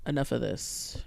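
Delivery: low stage aside. Retold or imitated. Comic telling the crowd, low and sly. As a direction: drop it down as a sly tease. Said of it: "I will go".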